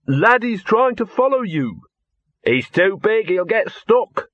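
high-pitched calling through rock. Acted. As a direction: pitch it up to reach the other side.

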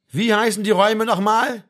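high, loud soldier's question; calling out. A narrator's reading. Baumann asks the sergeant to repeat, calling out. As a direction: call it from the ranks. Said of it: "rief Baumann".

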